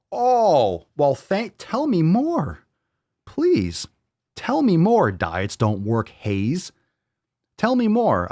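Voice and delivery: high, drawn-out fake eagerness; sarcastic mock interest. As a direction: pretend to be fascinated.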